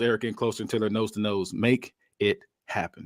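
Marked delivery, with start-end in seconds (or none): 1.5-3.0 menacing order. Acted. Voicing nose-to-nose menacing order.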